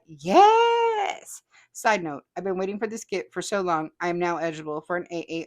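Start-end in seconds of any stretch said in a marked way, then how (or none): 0.1-1.4 high, loud triumphant yes; a jubilant shout of victory; excited yes. Real, not acted. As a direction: celebrate the win out loud. Cheering [name]'s own comment.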